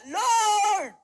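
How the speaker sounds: furious yell at the sky; loud angry outcry; angry shout. Acted. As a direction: cry out to heaven in rage. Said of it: "yung matanda sumigaw".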